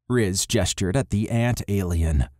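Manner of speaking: ordinary delivery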